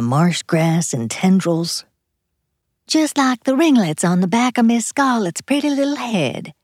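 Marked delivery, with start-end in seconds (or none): 2.9-6.5 high, hammed-up Southern belle accent; terrible Southern accent. Wife teasing husband about his home, in a mock Southern accent. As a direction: ham up a bad Southern accent, mocking. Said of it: "in a terrible Southern accent".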